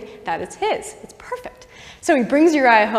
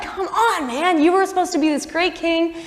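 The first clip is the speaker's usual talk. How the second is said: higher-pitched, exasperated pleading; acted dismay, pitched up and easing off; upset God; 5 semitones higher than usual. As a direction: play a let-down God reproaching his favorite. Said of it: "God is so upset".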